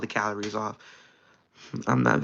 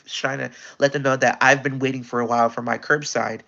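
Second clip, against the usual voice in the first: ordinary delivery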